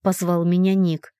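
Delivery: plain reading voice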